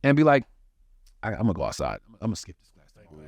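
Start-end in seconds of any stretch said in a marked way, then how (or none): 1.2-3.3 lower, much softer careless mumble; lazy mumble. Retold or imitated. Bored student planning to skip class, low lazy mumble. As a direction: shrug it off, barely bothering.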